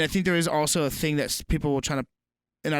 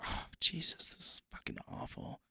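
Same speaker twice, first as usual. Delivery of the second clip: whispered, appalled aside; voiceless mutter of disbelief; hushed dismay; 15 dB quieter than usual. Real, not acted. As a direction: whisper it under your breath, horrified. Appalled aside while reading a headline.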